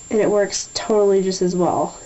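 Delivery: usual speaking voice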